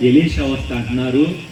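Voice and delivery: ordinary talk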